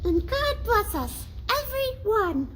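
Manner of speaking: put-on very high, small child's voice; a little boy squeaking a blessing; tiny voice. A narrator's reading. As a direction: pipe it like a tiny sick boy. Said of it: "in a very tiny voice".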